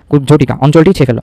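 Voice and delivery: ordinary talk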